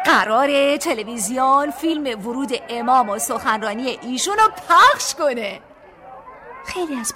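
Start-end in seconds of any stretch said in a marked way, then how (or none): 0.0-5.6 excited crowd. A narrator's reading. People announcing broadcast.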